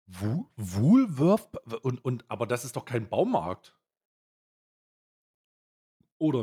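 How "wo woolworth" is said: incredulous. Real, not acted. Disbelief at Woolworth glue.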